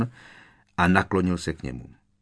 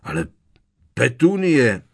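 loud, higher protest falling on the name; 5 semitones higher, 7 dB louder than usual. A narrator's reading. Strýc Vernon protests to Petunie, loud. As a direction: object with raised voice, exasperated.

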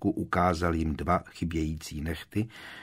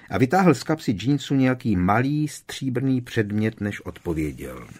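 usual speaking voice

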